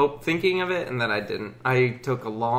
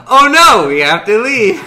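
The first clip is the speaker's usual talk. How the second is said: loud panicked outburst; mock panic; 5 semitones higher, 11 dB louder than usual. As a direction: shout it like fleeing. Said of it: "Did Alex go".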